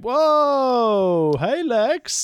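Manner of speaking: long, high, loud mock "whoa"; mock surprise. Co-host acting out surprise at Lex on bus, mock startled. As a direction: act out a comic startled greeting.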